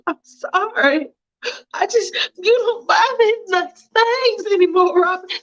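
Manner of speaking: wife apologizing to husband, teary; tearful wife. Acted. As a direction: apologize while holding back tears.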